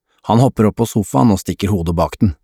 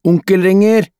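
high, raised shout; calling out; 11 semitones higher, 4 dB louder than usual. A narrator's reading. Adam tells mother uncle is phoning, calling out high. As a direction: call the news through the house. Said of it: "roper Adam".

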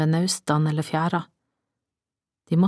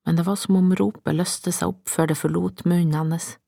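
plain reading voice

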